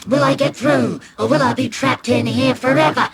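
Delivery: high, loud melodrama; hammed-up cliffhanger questions; dramatic adventurer. Child imagines being a trapped explorer.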